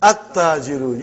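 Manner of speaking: "At-tajiru" with no l, a doubled t; lam silent. Shown as a demonstration. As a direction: go from a straight into a held t in "At-tajiru". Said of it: "You did not pronounce lam".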